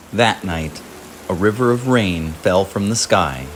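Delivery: ordinary delivery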